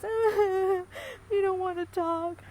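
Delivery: high, whiny fake sob, a bit slow; mock crying. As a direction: imitate grandma's put-on tearful plea. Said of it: "my mom pretended to cry".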